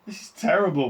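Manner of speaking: high, loud wail. A narrator's reading. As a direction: wail it in despair. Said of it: "wailed Missus T V".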